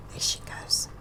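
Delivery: hushed storyteller aside; whisper. Acted. Reader whispering to children as Bird flies off, hushed. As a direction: whisper it to the little listeners. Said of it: "story aside to children".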